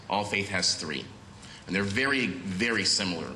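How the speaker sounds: ordinary delivery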